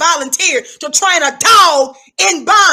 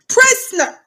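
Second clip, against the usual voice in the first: barked call; 8 semitones higher than usual. Shown as a demonstration. Guard addressing the inmate in the newsroom, barked call. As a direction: snap "Prisoner." out as a command. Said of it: "That's how I refer to it".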